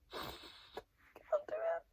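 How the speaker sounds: strained whisper, dragging slowly. Real, not acted. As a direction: whisper it, too overwhelmed to go on. Overwhelmed by the book.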